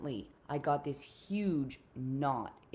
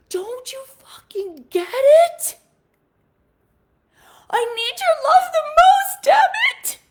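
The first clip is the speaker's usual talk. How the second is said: desperate furious shout; 19 dB louder than usual. Retold or imitated. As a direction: scream it with all the hurt. Daughter screams at father.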